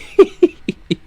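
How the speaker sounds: a sudden high, strangled laugh; despairing high laugh. Real, not acted. Team boss laughing in disbelief at his driver, high strangled laugh. Reacts to Bador in seventeenth.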